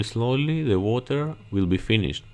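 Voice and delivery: normal talking voice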